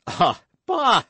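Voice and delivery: loud, high dismissive burst; scoffing exclamation. A narrator's reading. Guardsman dismisses the woman's objection, scoffing exclamation.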